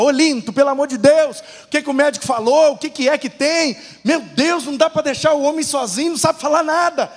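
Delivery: high, insistent nagging wife; exasperated pleading. Acted. Grandmother demanding what the doctor said, high exasperated plea. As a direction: act out a wife at the end of her patience.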